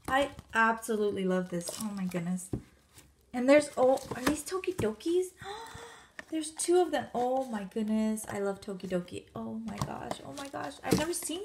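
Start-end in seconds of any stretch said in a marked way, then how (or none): 5.3-5.9 quick, soft gasp of surprise; excited gasp. Real, not acted. Finding Tokidokis in the package, excited gasp. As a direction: gasp at a lovely discovery.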